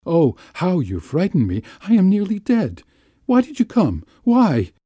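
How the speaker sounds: high, agitated, rising to a cry; distraught high-pitched reproach; frightened outburst. A narrator's reading. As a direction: plead in shock, pitch climbing steeply. Frightened lover confronts him at theater.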